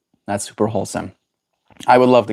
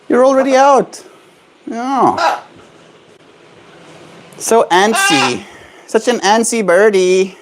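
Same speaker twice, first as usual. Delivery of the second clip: talking to a pet bird; 8 semitones higher, 4 dB louder than usual. Real, not acted. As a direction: squeak praise at the bird. Bird out, praising it.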